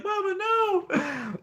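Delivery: very high, slow whining cry; hammed-up horrified son; mock wail. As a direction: drag it out in a shocked high wail. Will reacting to mom in bed.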